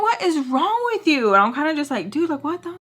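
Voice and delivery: high, incredulous retort; worked-up retold disbelief; exasperated. Confronting friend, fed up.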